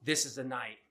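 a hint of a grim confession read aloud; reading the story. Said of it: "it starts out like".

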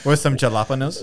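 "jalapenos" as "juh-LAP-uh-nohs", hard J and plain N; gringo mispronunciation. Shown as a demonstration. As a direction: misread "jalapenos" with American sounds. Joins deliberate mispronunciation joke.

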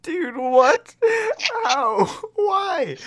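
high, loud disbelieving cry; shocked cry. Real, not acted. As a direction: shriek in disbelief. Shocked reaction, crying out.

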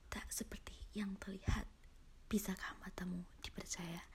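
hushed, fast whisper. Acted. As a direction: breathe it like a secret. Teaser for horror story. (berbisik misterius)

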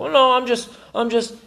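high, flustered stammer; flustered high protest. Pretend. Flustered self-defence.

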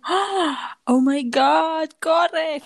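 high gasp, then drawn-out oh my God; retold alarm. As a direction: react as if hearing shocking news. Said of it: "Y yo como".

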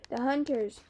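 restrained cry of alarm; screeched warning. A narrator's reading. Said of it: "Kiki screeched".

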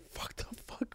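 whispered curse under the breath; panicked whisper. Retold or imitated. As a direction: hiss it so nobody hears. Panicked with no money.

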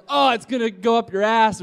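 loud, high mock warning shout; retold yell. Bystander warning the firework jumper, imitated high yell. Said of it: "Someone yells".

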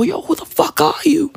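hushed whisper at his own reflection; whispered to the mirror. Retold or imitated. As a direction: whisper it, half spooked by the mirror. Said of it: "I look at myself in the mirror and I go".

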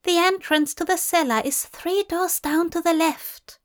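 high, whispery female voice; female bird character. A narrator's reading. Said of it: "she said".

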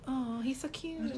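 very soft, slow wistful sigh of words; small, pining voice; mock disappointment. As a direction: let it sink away, quietly crushed. Let down by no match.

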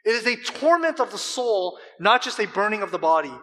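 loud, high, driving sermon voice; fervent preaching. Acted. Preacher quotes a commentator to the congregation, loud and fervent. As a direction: press on with rising intensity.

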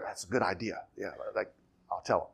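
low, very soft mumbled aside; mock agreeing. Imagined Jesus taking the disciples' counsel, softly mock agreeing. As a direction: play a half-hearted yes under the breath.

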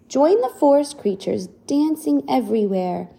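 ordinary delivery, nothing marked

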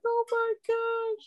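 high, drawn-out mock wail; slow, squeaky tearful exclamation; imitated crying. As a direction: act out bawling over happy news.

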